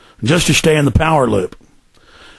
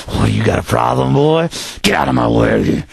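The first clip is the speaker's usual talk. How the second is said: lower, menacing put-on bully voice; bullying official; 5 semitones lower than usual. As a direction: drop low and threaten like a bully. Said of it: "they'll literally".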